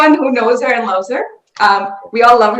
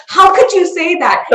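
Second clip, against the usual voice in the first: higher-pitched mock outrage; retold indignation; 7 semitones higher than usual. Aunt's friend scolding her over a hidden daughter, imitated indignation. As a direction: imitate a scandalized friend's reproach. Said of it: "her friend was just so, so angry".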